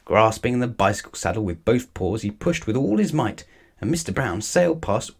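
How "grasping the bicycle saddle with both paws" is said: ordinary delivery, nothing marked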